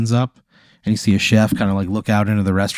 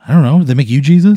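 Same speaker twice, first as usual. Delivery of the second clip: louder, higher, puzzled voice; incredulous dinner date; 5 semitones higher, 8 dB louder than usual. Acted. Date reacting to his Jesus mirror, loud incredulity. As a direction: question it in loud disbelief.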